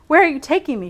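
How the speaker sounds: a contained scream within story narration; narrated scream. A narrator's reading. Doctor cries out to her kidnappers, a narrated mild scream. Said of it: "she screamed".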